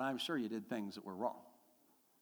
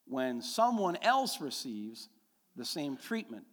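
normal talking voice

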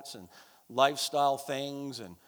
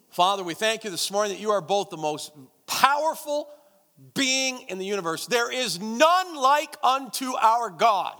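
loud, higher impassioned prayer; fervent prayer; 6 semitones higher, 7 dB louder than usual. Acted. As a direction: pour it out to God with full force. Leads the congregation in prayer.

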